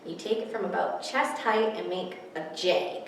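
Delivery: ordinary talk, nothing marked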